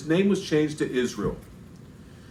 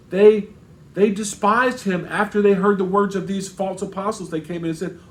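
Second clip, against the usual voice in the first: usual speaking voice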